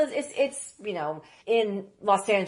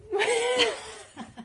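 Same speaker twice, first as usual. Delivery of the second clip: burst of laughter. Real, not acted. Hearing the host's fake coffee name, bursting out laughing. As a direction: crack up in disbelief.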